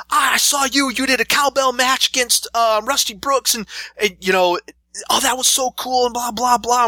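put-on high, breathless fan kid gushing. Nine-year-old fan tells the wrestler about his match, gushing and high. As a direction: rattle it off like an eager young fan.

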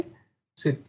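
ordinary delivery

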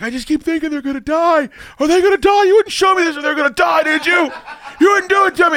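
high, loud imitation of a panicked girlfriend; hammed-up frantic accusing shriek; retold screaming. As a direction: scream it high, as a mock meltdown. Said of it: "She's, like, screaming at me".

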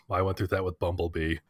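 offhand Optimus. Retold or imitated. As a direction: toss it off like a weary robot dad. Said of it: "Optimus just offhand goes".

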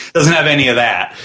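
usual speaking voice

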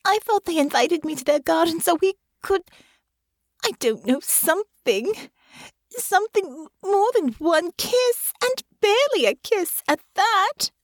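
high, tearful lament, slowing; weeping young woman complaining to her friend; crying. A narrator's reading. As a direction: sob it out in a high voice. Honor crying after tears start.

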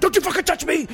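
high, loud furious snap; angry shout. Retold or imitated. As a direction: bark it high and loud to keep him off. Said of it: "he's like".

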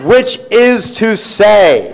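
high, slow and loud, stretched out grandly; Shatner finale. Acted. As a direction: land the punchline with a huge Shatner flourish. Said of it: "read this in my best William Shatner voice".

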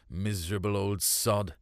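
ordinary delivery, nothing marked

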